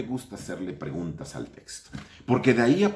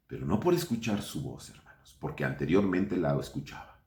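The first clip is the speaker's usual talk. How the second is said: ordinary talk, nothing marked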